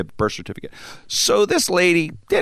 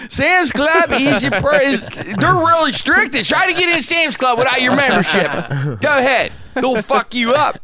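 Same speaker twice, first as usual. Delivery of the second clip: joking about Sam's Club being strict; mock rant; 9 semitones higher than usual. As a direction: warn the crowd with hammed-up gravity.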